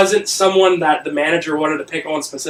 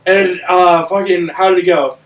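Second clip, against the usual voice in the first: normal talking voice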